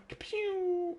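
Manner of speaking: high zapping pew sound; gun noise. Pretend. Player mock-shooting Simon from afar, high zap noise. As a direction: fire off a toy laser blast.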